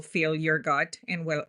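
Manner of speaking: ordinary delivery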